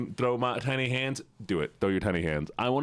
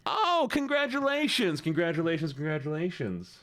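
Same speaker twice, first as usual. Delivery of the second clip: excited congratulations; 5 semitones higher than usual. Real, not acted. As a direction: gush congratulations on a high pitch. Congratulates a viewer on free commission.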